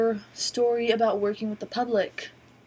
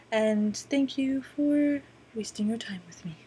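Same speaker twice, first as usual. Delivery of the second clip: whispered sign-off. Real, not acted. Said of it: "whispered sign-off".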